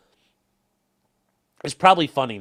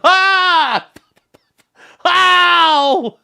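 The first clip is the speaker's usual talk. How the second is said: high, loud hammed-up cries of pain; overacted yelp and ow; fake pained scream; 9 dB louder than usual. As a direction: scream as if shot on camera. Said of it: "it'll be a cam of me going".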